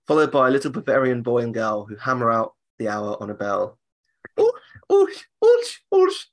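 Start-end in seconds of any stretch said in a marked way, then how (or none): 4.4-6.2 very high whispered oosh sounds; imitated hammering noise. Speaker imitating the clock figures' high breathy oosh. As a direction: squeak out the bell strikes. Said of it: "why they make that noise".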